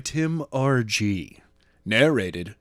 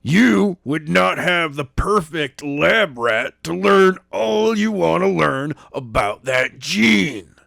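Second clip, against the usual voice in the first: louder, slow, hammered out; slow angry shout; 5 semitones higher, 4 dB louder, slower than usual. A narrator's reading. Daniels rounds on the man with the tie, angry. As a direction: glare and lay it down. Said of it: "shouted Daniel".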